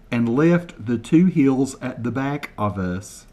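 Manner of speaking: slow, deliberate over-enunciation of each word; slow mock translation. Shown as a demonstration. Translating Kevin's Scottish for viewers, slow and deliberate. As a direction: spell it out slowly for Americans, deadpan. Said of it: "translate word for word".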